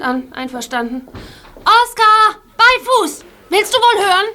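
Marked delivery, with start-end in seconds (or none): 1.6-4.2 high, loud, stern commands; firm dog command. Acted. Gabi commanding disobedient Oskar to heel, loud and stern. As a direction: make the dog obey.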